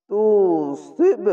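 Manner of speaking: slow তুসিব with the ব bounced then dropping; qalqala bounce. Shown as a demonstration. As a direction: knock the ব and let it fall. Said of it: "কলকলা ধাক্কা মেরে নিচে আসবে". (কলকলা দিয়ে তুসিব)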